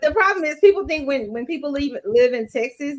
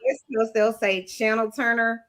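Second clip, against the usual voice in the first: usual speaking voice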